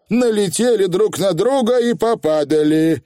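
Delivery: plain reading voice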